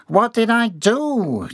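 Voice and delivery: high, drawn-out bewildered wail; confused. A narrator's reading. Fired employee asking what he did, confused. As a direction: plead in whiny bewilderment. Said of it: "John was genuinely confused".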